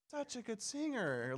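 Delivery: high, slow mock mom praise; gushing mom. Mom praising her child's singing, mock gushing. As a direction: imitate a mother's high, drawn-out praise. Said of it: "All those times your mom said".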